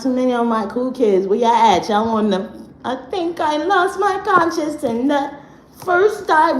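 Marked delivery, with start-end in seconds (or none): 2.8-6.6 higher, dreamy, lost-sounding quote; mock spacey voice. Teasing followers who feel lost, mock spacey voice. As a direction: play the confused listener lightly.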